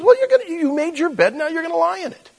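very high-pitched scolding; mock condemnation. God imagined blaming a sinner, mock scolding. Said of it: "God doesn't look at you and me and says".

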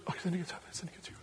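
hushed, muttered practice lines; whispered rehearsal. Acted. Son practising his speech to himself, whispered. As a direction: rehearse it under your breath.

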